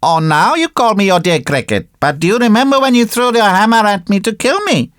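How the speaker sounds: high little insect voice, scolding; reproachful cricket. Acted. Cricket reminds puppet of the hammer, high and reproachful.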